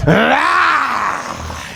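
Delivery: high mock crackhead shriek; crackhead screech. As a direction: squeal like something from the bushes. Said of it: "just fucking".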